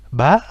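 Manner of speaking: ba qui monte franchement; ba lancé vers le haut; acute tone. Shown as a demonstration. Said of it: "avec un accent aigu". (ba avec accent aigu)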